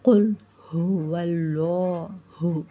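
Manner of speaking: कुल हुवल्लाहु recited slowly with a heavy, dark लाह; slow recitation. Shown as a demonstration. Quran teacher recites slowly so students hear the heavy lam. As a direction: draw out हुवल्लाहु, thick lam. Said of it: "लफ्ज़ जलाला को मोटा पढ़ेंगे".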